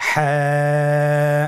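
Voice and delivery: হ্যাঁ held very long with a throaty, squeezed h; drawn-out letter name. Shown as a demonstration. Teacher leads students in repeating the letter, slowly drawn out. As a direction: hold হ্যাঁ long, pressed from mid-throat. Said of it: "গলা চিপে ধরে আপনি উচ্চারণ করুন".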